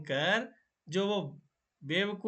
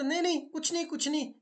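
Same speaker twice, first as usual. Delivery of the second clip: flustered denial; 10 semitones higher, faster than usual. Acted. Jackal covers his slip.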